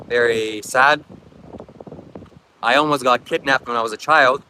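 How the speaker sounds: normal talking voice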